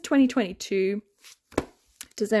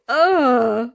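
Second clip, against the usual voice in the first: loud, fed-up groan; exasperated groan; 6 dB louder than usual. Real, not acted. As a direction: groan out the frustration. Exasperated with the book series.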